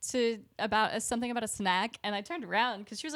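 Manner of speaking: ordinary talk, nothing marked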